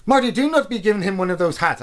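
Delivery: a clumsy try at an Irish mum; bad Irish accent. Movie mum scolding Marty, bad Irish accent. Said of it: "I can't do an Irish accent".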